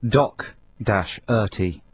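Doc dash Erty read slowly in separate pieces; spelled-out pronunciation. Shown as a demonstration. Co-host reading out the playlist note, Doc dash Erty slowly piece by piece. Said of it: "it says here on our notes".

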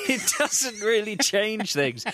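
slow words through laughter; laughing. Real, not acted. Laughing at own joke.

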